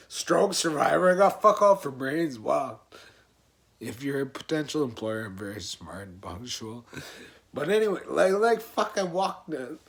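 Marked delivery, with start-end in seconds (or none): 3.8-6.8 low, softer deadpan aside; mock-formal aside. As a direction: drop low for a tongue-in-cheek job pitch. Mock aside to potential employers.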